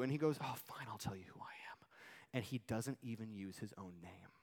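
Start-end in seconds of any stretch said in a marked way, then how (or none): 0.4-1.9 hushed, whispery concession; reluctant giving-in. Acted. John answering those who ask who he is, hushed reluctance. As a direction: give in reluctantly, very soft.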